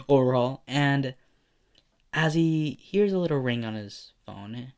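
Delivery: usual speaking voice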